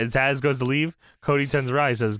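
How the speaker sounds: normal talking voice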